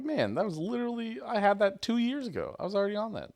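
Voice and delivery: high, frustrated exclamation; raising the pitch in disbelief; excited inner rant. Retold or imitated. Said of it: "I was like".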